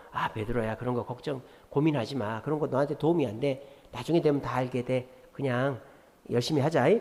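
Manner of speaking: calm Peter down like a friend; Jesus softly comforting Peter; gentle coaxing. Retold or imitated. Gentle alternative answer to Peter.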